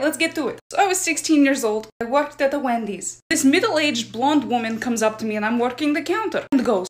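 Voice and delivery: usual speaking voice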